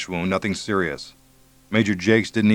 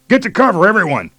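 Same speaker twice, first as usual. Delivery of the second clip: loud, high battle shout; 12 semitones higher, 8 dB louder than usual. A narrator's reading. Major to his squad under fire, loud shout. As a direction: yell the order over gunfire. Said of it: "Jake shouted".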